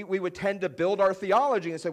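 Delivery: ordinary talk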